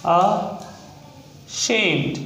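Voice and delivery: Ashamed very slow, split into "a... shamed"; sounding out. Shown as a demonstration. Teacher breaking an example word down for learners, slow syllables. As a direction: draw out ashamed with a long gap after the first sound.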